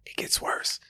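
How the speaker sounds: breathy whisper of It gets worse; whispered teaser. Real, not acted. Teasing his listener about the story, in a whisper.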